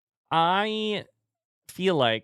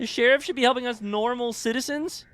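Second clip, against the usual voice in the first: incredulous; 12 semitones higher than usual. Real, not acted. Echoing game line incredulously.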